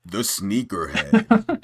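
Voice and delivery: low, slow, heavy "The sneakerhead"; bouncer voice. Shown as a demonstration. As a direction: growl it slowly like a bouncer. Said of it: "say it with the bouncer voice".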